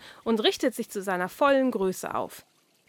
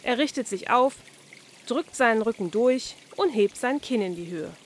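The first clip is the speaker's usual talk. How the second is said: plain reading voice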